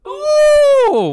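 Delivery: loud, very high "ooh"; high whoop. Real, not acted. Host closing out the show, high loud whoop.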